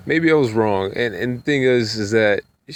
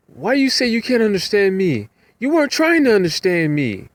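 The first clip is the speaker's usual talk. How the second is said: put-on old lady's voice; 11 semitones higher than usual. Passenger accusing the driver of not trying, high put-on voice. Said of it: "Talking like that".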